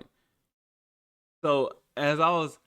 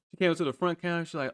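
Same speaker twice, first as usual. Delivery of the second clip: ordinary delivery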